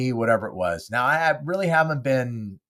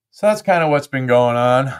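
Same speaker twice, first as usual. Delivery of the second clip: ordinary delivery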